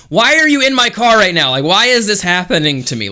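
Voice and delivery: high, loud retold protest; exasperated outburst. Driver stuck with unwanted passenger, acted exasperated protest. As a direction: wail it in acted exasperation, easing slightly.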